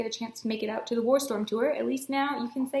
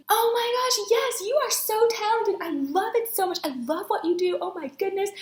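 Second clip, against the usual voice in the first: high, effusive gushing praise; high-pitched, delighted fangirling over someone; 10 semitones higher, 4 dB louder than usual. Retold or imitated. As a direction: imitate an author gushing over a fan. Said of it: "gushing about me".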